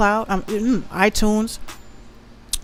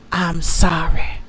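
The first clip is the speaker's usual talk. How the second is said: mocking whisper; 3 semitones lower, slower than usual. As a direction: whisper it like a meek apology. Mocking the kid's apology.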